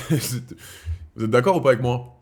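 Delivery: amused, drawn-out question to the viewers; laughing question. Real, not acted. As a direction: laugh it out while asking the audience.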